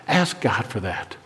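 usual speaking voice

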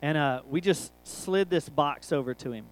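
ordinary delivery

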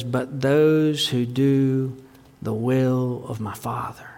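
slow, soft, breathless whisper of the words; hushed whisper. Acted. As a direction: lean in and whisper it slowly, the last words voiceless. Jesus' words said hushed, then repeated.

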